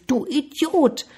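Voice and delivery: high-pitched annoyed storybook cry; annoyed call. A narrator's reading. Boy feels mocked by the voice, annoyed high call. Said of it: "Der Junge war verärgert".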